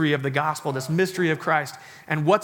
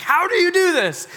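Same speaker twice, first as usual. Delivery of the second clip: shouted, much higher, in mock disbelief; amazed disbelief; 13 semitones higher, 6 dB louder than usual. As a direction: throw your hands up, astonished she found it. Husband amazed at wife finding.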